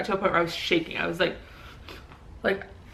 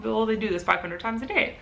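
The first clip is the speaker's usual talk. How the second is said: ordinary delivery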